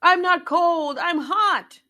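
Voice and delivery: loud, high sudden outburst; blurted out. A narrator's reading. Billy confessing to Nat in a loud high blurt. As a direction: burst out with the confession. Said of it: "blurted out Billy Goat".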